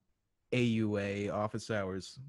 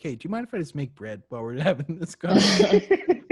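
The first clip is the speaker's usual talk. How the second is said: loud, higher quote breaking into laughter; laughing imitation; 6 semitones higher, 9 dB louder than usual. Co-host teasing friend about Zoom habits, laughing imitation. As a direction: crack up while quoting a friend.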